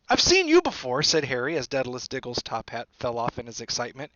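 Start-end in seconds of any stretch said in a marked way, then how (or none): 0.1-1.0 put-on high, loud boyish exclamation; surprised recognition. A narrator's reading. Harry recognising Diggle, high surprised voice. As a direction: blurt it out on recognising him.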